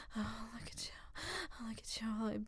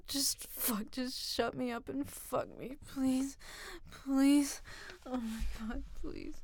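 desperate pleading. Acted. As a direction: beg with rising urgency. Loud desperate pleas.

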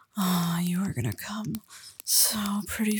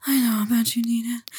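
louder, insistent whisper; 7 dB louder than usual. Acted. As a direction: push the whisper with more force. Repeated line, louder insistent take.

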